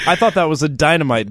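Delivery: laughing, high mock outrage. Real, not acted. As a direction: protest through laughter.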